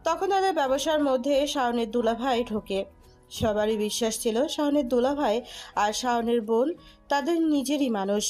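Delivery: usual speaking voice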